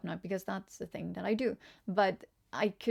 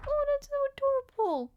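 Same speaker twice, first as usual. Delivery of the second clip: pitched way up, squealing over how cute it is; real delight at something adorable; high-pitched gushing. Real, not acted. Delighted by a new emote.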